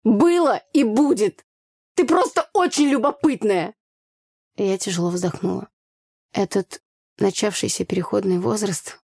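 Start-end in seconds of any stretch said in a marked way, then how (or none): 0.0-3.8 higher, louder, drawn-out retort; angry retort. A narrator's reading. Brother calls sister too curious, angry retort. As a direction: hurl it back at a nosy sister.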